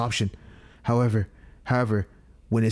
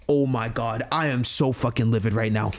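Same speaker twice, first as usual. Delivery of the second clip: ordinary talk, nothing marked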